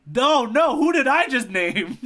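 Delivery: laughing through the words, pitched high; cracking up at his own mistake; real giggling disbelief. Real, not acted.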